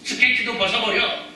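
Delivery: high, loud bystander yell, easing slightly at the end; shouting to someone. Acted. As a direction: call out a warning to someone drowning. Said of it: "사람들이 소리칩니다".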